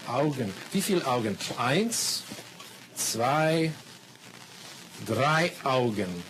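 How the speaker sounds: slow, exaggerated words for learners; careful classroom German, slowed down; slow teacher talk. Shown as a demonstration. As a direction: spell it out slowly for beginners. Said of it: "you have to watch me carefully".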